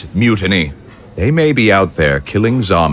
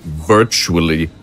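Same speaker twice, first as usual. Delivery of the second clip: scornful echo; 6 semitones lower, slower than usual. Acted. Consul mocks general's word.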